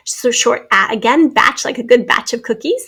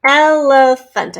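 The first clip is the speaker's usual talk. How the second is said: slow, stretched-out ELEPHANT; syllable by syllable; slower than usual. Shown as a demonstration. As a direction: say Elephant drawn out in three beats. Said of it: "three syllable word we're looking at".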